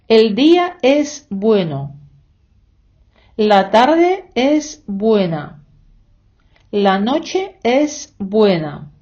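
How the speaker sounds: slow, measured Spanish with each word set apart; slow model reading. Shown as a demonstration. Teacher reading Spanish example sentences for students, slow and deliberate. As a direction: read each example slowly for learners.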